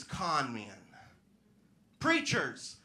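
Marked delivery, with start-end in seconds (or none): 2.0-2.8 higher, loud ringing call; preacher boom. Pretend. Comic naming her family trade, preacher-style call. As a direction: proclaim it from the pulpit. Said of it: "Yeah, sorry".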